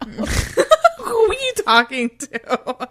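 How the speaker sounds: loud, laughing disbelief; laughing at her cohost talking to nobody. Real, not acted. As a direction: ask through laughter.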